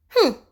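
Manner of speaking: high, loud dismissive humph; a snooty sniff of contempt; huffing at the goblin; rude sniff. Acted. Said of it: "sniffed Mister Uppity rudely".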